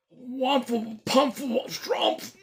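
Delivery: high muffled mumbling; muffled inside hat. Acted. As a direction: talk with a hat over your face. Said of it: "voice from inside the hat".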